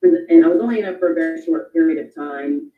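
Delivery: ordinary talk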